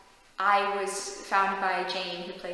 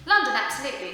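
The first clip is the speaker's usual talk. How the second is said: louder, higher English-accented catchphrase; English accent, pitched up and projected; 5 semitones higher, 8 dB louder, faster than usual. Shown as a demonstration. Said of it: "my phrase for England is London".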